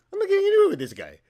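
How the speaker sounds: high, loud, fast frustrated squeal; exasperated. Retold or imitated. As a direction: blurt it out in comic frustration. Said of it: "I'm going".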